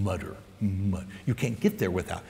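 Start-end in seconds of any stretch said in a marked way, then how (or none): 0.0-1.1 mutter, said low and soft; low muttered word. Shown as a demonstration. Preacher showing the church how mutter sounds. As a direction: drop it into a low grumble. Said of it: "to say something disgusting in a low tone".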